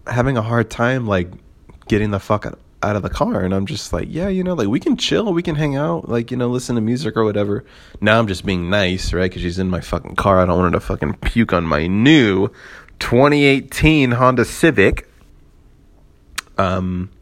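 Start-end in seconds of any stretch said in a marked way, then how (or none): 4.1-7.6 sweet, high and placating; sugary fake niceness; overly nice high voice. Retells himself being nice. 13.0-15.1 "twenty eighteen Honda Civic" slow, loud and high; each word of "twenty eighteen Honda Civic" punched; emphatic slow stress. Real, not acted. Proud of his car.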